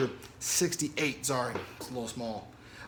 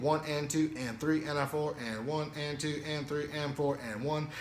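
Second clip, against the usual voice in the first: steady spoken count of eighth notes; counting the rhythm; 4 dB quieter than usual. Shown as a demonstration. Drum teacher counting students through the rhythm. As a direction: count it out evenly, in time. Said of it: "Eighth notes in the beginning".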